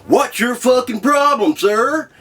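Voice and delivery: hammed-up outraged officer; angry cop. Cop leaning into the car window, mock angry bark. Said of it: "and he goes".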